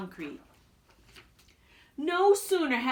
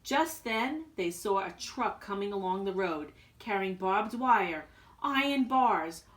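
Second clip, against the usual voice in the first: ordinary delivery, nothing marked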